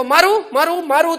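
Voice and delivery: mock threat. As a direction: bark the threat over and over, hammed up. Acting a daily threat.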